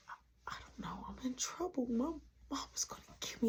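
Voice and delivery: very soft, half-whispered dread; acted hushed worry; fearful confession. Confessing she is in trouble.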